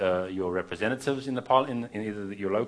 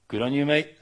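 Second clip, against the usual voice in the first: put-on a half-hearted stab at an Australian accent, slightly higher and louder; attempted Australian accent; 5 semitones higher, 4 dB louder than usual. Visiting preacher to an Australian crowd, a mock Australian accent. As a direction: toss off a self-conscious Australian "Good on you, mate". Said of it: "I can't do the accent".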